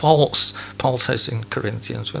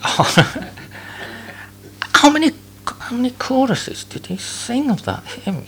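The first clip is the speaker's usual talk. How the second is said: higher grumble without moving the lips; ventriloquist mutter; 12 semitones higher than usual. Retold or imitated. Churchgoer grumbling about the hymn, muttered. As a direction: gripe sideways like a ventriloquist. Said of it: "speak like somebody doing ventriloquism".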